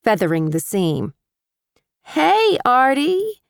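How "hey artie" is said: high, forced cheerful greeting; forced breezy. A narrator's reading. Tenant greeting the landlord, trying to sound breezy. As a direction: fake breezy, covering nerves. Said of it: "I attempted to sound breezy".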